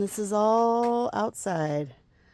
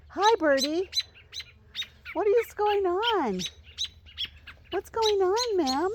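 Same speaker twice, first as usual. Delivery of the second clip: sing-song high coo to a bird, much higher; high pet voice; 12 semitones higher than usual. Real, not acted. Gardener cooing at a bird near its nest, high sweet voice.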